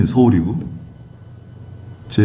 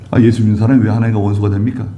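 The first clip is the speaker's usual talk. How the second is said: ordinary delivery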